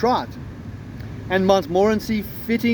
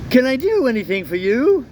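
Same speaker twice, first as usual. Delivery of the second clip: higher, polite feline voice; cat voice; 5 semitones higher than usual. Acted. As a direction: voice the cat, lifted and courteous. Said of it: "That's my cat voice".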